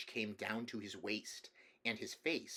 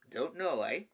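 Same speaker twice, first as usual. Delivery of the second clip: put-on raised, louder echo of a child's answer; patronising uncle's retort; tiresome grown-up talk; 6 semitones higher, 4 dB louder than usual. A narrator's reading. King throwing his nephew's answer back, tiresome grown-up talk. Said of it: "talking in the tiresome way".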